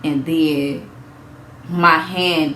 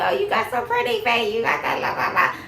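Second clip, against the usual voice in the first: higher, softer cooing voice; imitating onlookers; 5 semitones higher, 4 dB quieter than usual. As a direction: coo the compliments mockingly. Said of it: "everybody was coming".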